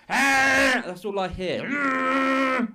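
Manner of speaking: high, loud, pained mock cry; mock anguished yell. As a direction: wail it like it hurts to hear. Mocking his singing, yelling.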